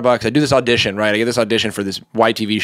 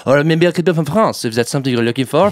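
higher-pitched put-on French accent; 4 semitones higher than usual. Shown as a demonstration. Actor pitching himself to casting, French accent. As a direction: offer yourself in a French accent. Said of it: "I love to do accents".

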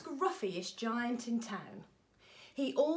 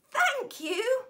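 1 goat voice; 13 semitones higher, 8 dB louder than usual. A narrator's reading. Goat thanks George.

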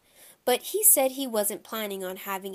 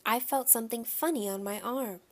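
ordinary delivery, nothing marked

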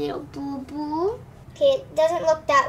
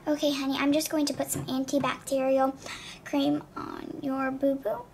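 ordinary delivery

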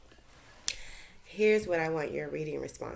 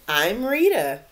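louder, a lightly voiced young remora; girl fish voice; 5 semitones higher, 12 dB louder, slower than usual. Rita the remora introduces herself to Gilbert, lightly voiced. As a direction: say your name shyly but clearly.

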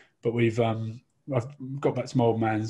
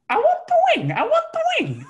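put-on childish whine; 6 dB louder than usual. Drunk friend grabbing turkey wing.

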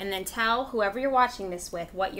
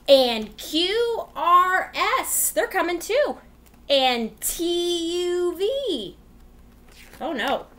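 louder and higher as letters pile on; building excitement; 7 semitones higher, 4 dB louder, much slower than usual. Acted. Teacher building suspense for kids as letters climb.

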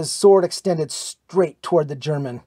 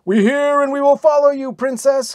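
much higher, louder sworn pledge; fierce answer; 11 semitones higher, 7 dB louder than usual. A narrator's reading. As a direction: call it out like warriors swearing loyalty. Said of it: "came the fierce answer".

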